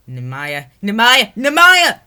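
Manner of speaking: rising to a very high, loud scream. Acted. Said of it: "her screaming in Ukrainian".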